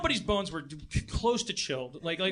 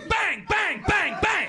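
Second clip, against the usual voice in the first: mock gunshots; 9 semitones higher, 3 dB louder, slower than usual. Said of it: "imitated gunshots".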